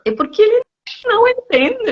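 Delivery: emphatic correction. Retold or imitated. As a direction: insist in a high voice that he simply doesn't understand. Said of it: "Eu falo".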